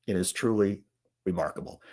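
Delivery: remarkable dropped low and soft. Real, not acted. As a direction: trail off in dismay. Quiet disbelief.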